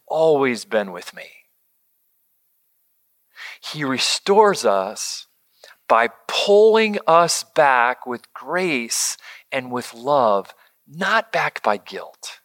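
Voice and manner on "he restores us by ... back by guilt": slow and hushed, partly whispered; earnest, tender hush. Real, not acted. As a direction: lay each word down gently. Slow hushed emphasis on grace.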